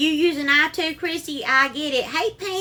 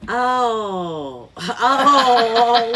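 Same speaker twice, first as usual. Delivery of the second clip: a real laugh breaking into the word; 3 semitones lower, 6 dB louder than usual. Real, not acted. Host laughing at a viewer's comment during her live, laughing out loud.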